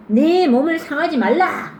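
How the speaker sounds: high, loud call to stop the jailer; loud shout. Acted. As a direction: shout it out to stop him in time. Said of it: "바울이 크게 소리질러". (간수를 말리려고 크게 외치는 바울)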